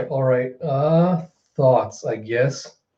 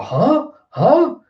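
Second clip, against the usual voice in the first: high, startled huh twice; confused double take; 11 semitones higher, 4 dB louder than usual. Retold or imitated. A bystander hearing Kaido's plan, startled and high. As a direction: jolt up in sudden confusion. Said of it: "And you have a".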